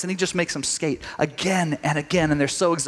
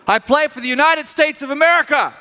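higher, louder proud declaration; proud shout; 8 semitones higher, 3 dB louder than usual. Retold or imitated. The player answers the coach for his country, proudly shouted. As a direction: call it out with pride.

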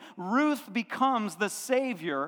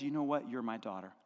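soft, low and tender; tender Naomi; 10 semitones lower, 9 dB quieter, faster than usual. Retold or imitated. Naomi accepts Ruth as her daughter, soft and tender. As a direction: hush it like a mother's blessing.

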